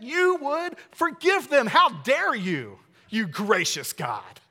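high, slow indignant whine; how dare you, spoken with mock indignation; mock outrage. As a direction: pastor voicing Jonah's outrage at God's grace, mock angry. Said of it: "Jonah says".

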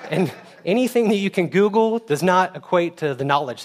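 real laughter carried into the point; laughing. Real, not acted. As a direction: still chuckling from the joke. Laughing after joke.

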